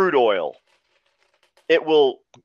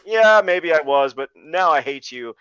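slow deadpan; 4 dB louder than usual. Retold or imitated. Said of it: "And Costner's like".

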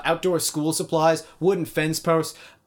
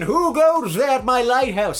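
put-on slow, high old sea dog; old lighthouse keeper voice; 8 semitones higher, 5 dB louder, slower than usual. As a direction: growl it like a crusty lighthouse keeper. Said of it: "the old man Mulligan".

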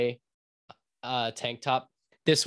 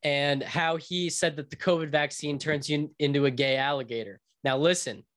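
normal talking voice

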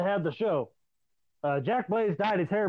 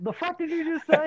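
incredulous retort; 9 semitones higher than usual. Retold or imitated. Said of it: "everybody that knew you turned and went".

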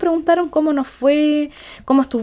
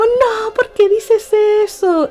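puzzled friend; 8 semitones higher, slower than usual. Retold or imitated.

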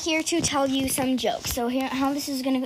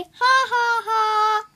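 mock laugh; 9 semitones higher, 4 dB louder, much slower than usual. Fake laugh after joke.

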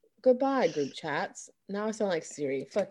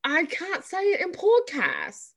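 put-on English accent; 6 semitones higher than usual. Host defending her missing D, in high mock English accent. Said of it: "throw the whole accent off".

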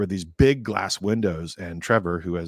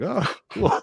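high, laughing oh cool; mock-casual surprise at something odd; laughing imitated reaction to a Satan figure; surprised; 13 semitones higher than usual.

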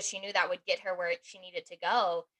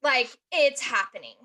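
louder and higher, fed-up cry; exasperated outburst; 4 semitones higher, 6 dB louder than usual. Retold or imitated. Realizing dad's warning came true, louder exasperated outburst. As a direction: throw it out, beaten by the truth. Realizing her dad was right.

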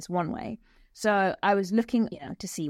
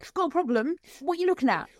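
aggressive English bloke; 8 semitones higher, faster than usual. Retold or imitated. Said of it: "they're like".

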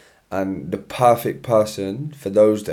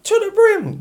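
squeaky high catchphrase; very high mock "To the brim"; TikTok guy impression; 6 dB louder than usual. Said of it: "the guy on TikTok just like".

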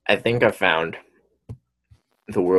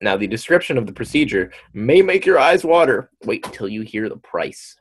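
higher, teasing build to the price; news read-aloud; 5 semitones higher, 3 dB louder than usual. Acted. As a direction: read it out with suspense, gasping before the figure. Reads news story aloud.

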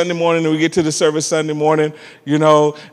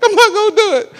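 put-on stubborn child; faster than usual. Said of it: "I was like".

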